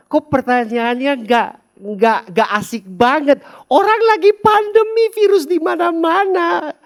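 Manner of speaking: high, loud exasperated outcry; mock exasperation. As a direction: throw up your hands in comic dismay. Preacher exclaims in mock dismay.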